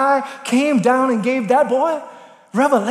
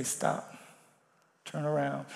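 hushed, very soft, barely above breath; soft whisper from God; 9 semitones lower, 17 dB quieter than usual. Acted. Preacher voicing God softly: Stop, turn around, hushed. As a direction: lean close and let it fall to a hush. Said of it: "God, you might whisper in my ear".

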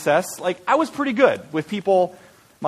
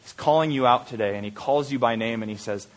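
ordinary talk, nothing marked

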